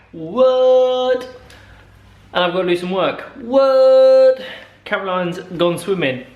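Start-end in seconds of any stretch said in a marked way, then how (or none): 0.1-1.2 high, loud mock outrage; mock surprise. Mock shock at news. 3.4-4.4 high, loud mock outrage; mock surprise. Mock shock at news.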